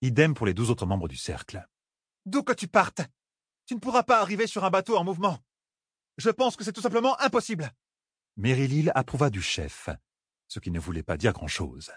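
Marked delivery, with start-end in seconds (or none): 2.3-7.7 high, fast firm voice; insistent heir. Acted. Elaine insisting to Renaile, high fast voice. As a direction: insist it can't be done.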